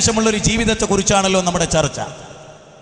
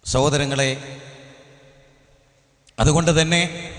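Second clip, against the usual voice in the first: low, softened preaching with long pauses; subdued sermon; 4 semitones lower than usual. Real, not acted. Preacher asking listeners to stay until the end, subdued appeal. Subdued, measured address to gathering.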